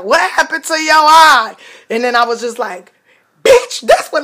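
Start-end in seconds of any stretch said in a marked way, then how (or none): 0.0-1.5 heckler calling out. Retold or imitated. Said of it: "somebody's like". 3.4-4.2 high, loud furious retort; yelling back. Retold or imitated. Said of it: "I'm yelling at everybody".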